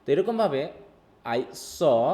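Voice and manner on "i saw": "saw" held long after a small gap; slow stress on saw. Shown as a demonstration. Teacher shows slow focus on the verb, "saw" drawn out. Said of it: "সটাকে আমি slowly বলছি".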